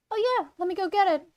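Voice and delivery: high, quick character voice; Zoe's voice. Acted. Zoe runs off for the costume, high quick voice. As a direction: pitch up and answer briskly. Zoe answers Claire in roleplay.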